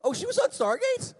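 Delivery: very high, loud rising question; mock surprise. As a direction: feign astonished ignorance, squeaking up at the end. Feigns ignorance of co-star.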